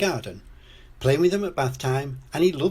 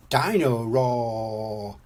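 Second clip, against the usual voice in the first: very slow, drawn-out toy name; excited; much slower than usual. A narrator's reading. George names his new toy, mildly excited. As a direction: stretch out both words with mild excitement. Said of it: "says George excitedly".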